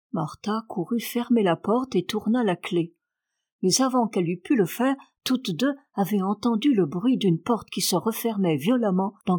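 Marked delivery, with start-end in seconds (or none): none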